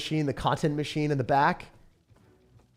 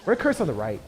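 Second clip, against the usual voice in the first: ordinary talk, nothing marked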